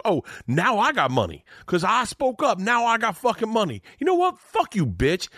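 Tracy scolding the waitress in a high, hammed-up rage; mock Tracy Morgan outrage. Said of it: "He goes".

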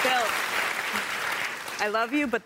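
fond but exasperated, slowed down; loving exasperation. Real, not acted. Panelist pushes back on host.

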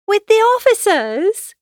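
high, loud, excited question; Lydia crying out. A narrator's reading. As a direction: burst out like a thrilled young sister. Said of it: "cried Lydia".

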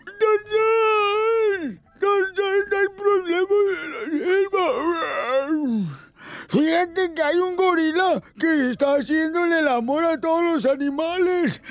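high, strained voiceless cry; hoarse tiger. Acted. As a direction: call out like a tiger who lost his voice. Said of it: "llega el tigre afónico". (tigre afónico, voz ronca y aguda)

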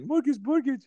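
put-on high, sing-song babying voice; mortgage lady baby talk. Mortgage lady babying the comedian, high sing-song. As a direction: sing it like she's talking to a baby. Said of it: "I have a mortgage lady. And she's like".

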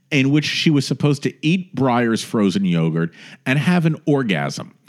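ordinary talk, nothing marked

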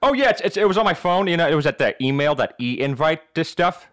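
bluffing, stammering cartoon dad voice; Peter Griffin. Crasher explaining his missing invitation, cartoon impression. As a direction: make excuses as a cartoon goofball. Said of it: "in Peter Griffin accent".

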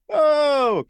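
high, loud, drawn-out "oh"; mock catchphrase holler. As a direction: belt it like a hacky comic. Mocking comics who rely on catchphrases.

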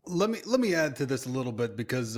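usual speaking voice

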